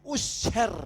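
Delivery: "usher" read with an OO first vowel; slow, misread "usher" starting with OO; mispronounced usher. Shown as a demonstration. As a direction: say "usher" as OO-sher, drawn out. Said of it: "Asher, dibilang usher".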